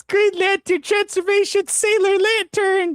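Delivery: put-on high, slow magical girl call; bright magical girl. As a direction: announce the transformation up high.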